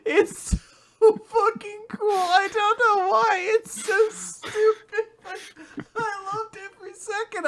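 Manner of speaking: high, laughing squeal of delight. Real, not acted. Fan raving about a cool manga scene, squealing high. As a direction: gush like a fan who can't contain it.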